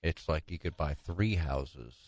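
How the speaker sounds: normal talking voice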